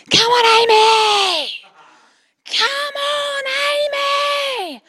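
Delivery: loud, high, drawn-out cheer at full pitch; top-of-his-voice shout. Retold or imitated. Dad cheering on his daughter at sports day, shouting. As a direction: bellow it slowly across a crowded field. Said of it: "at the top of his voice, Dan shouted out".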